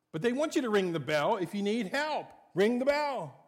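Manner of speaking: full-force preaching. Acted. Preacher drives home the blessing to the congregation, loud and high. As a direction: preach it with fire.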